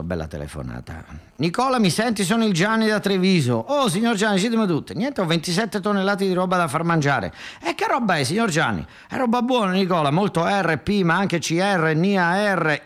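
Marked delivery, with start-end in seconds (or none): 1.4-3.5 higher, bossy Treviso boss voice; northern businessman on phone. Acted. Phone call, northern industrialist. 3.5-12.8 higher, chatty back-and-forth characters; phone deal voices. Acted. Gianni and Nicola haggling over toxic waste, higher voices. Acted phone dialogue both parts.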